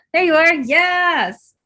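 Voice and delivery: loud, high burst of real excitement; excited. Real, not acted. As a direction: cheer them on, drawn-out and high. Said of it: "Sorry, I get excited".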